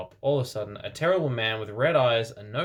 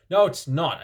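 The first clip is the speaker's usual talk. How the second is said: louder, higher angry retort; Ebony shouting at Vampire, loud; 6 semitones higher, 7 dB louder than usual. A narrator's reading. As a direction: snap it back loudly. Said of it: "I shouted angrily".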